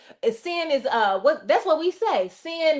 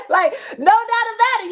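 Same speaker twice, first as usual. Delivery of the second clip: laughing; 7 semitones higher, 4 dB louder than usual. Real, not acted. Laughing at own God bit.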